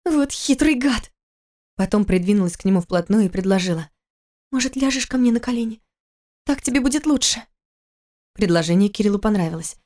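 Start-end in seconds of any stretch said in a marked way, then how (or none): 0.1-7.4 высокий женский голос, мягко возражает; жена успокаивает мужа; жена оправдывает. Acted. Голос Киры. (высоко, мягко)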